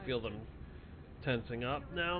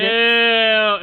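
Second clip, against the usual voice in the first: disgusted; 12 semitones higher, 19 dB louder than usual. Real, not acted. Disgusted by the jar.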